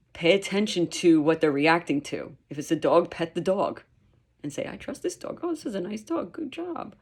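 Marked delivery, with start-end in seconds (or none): 4.7-6.9 high, soft pet-talk voice; gentle praise. Shown as a demonstration. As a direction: coo softly to a dog. Acting out praise to a dog.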